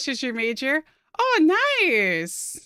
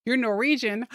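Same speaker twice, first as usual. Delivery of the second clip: usual speaking voice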